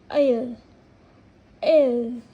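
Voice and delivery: एल said loud and clear, twice; stressed sounding out. Shown as a demonstration. Teacher calling out एल loudly to show the vowel sound. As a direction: punch एल out loud so the long ई rings. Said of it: "ई की proper sound आ रही है".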